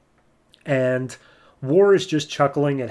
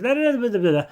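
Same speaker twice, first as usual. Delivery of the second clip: high, rapid run of no's in mock shock; disbelief; 10 semitones higher, much faster than usual. Firstborn refusing to believe the scientist, high mock disbelief. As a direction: stammer out the denial, much higher. God hears seven thousand years.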